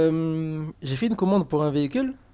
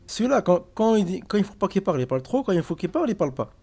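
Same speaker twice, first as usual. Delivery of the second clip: raised, fed-up complaining; exasperated rant; 6 semitones higher, 4 dB louder than usual. Real, not acted. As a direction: vent loudly about him being useless. Fed-up rant about a colleague.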